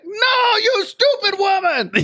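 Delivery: very high, drawn-out exasperated cry; mock scammer fury. Scammer losing it at old lady, high mock fury. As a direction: wail the insult in put-on rage.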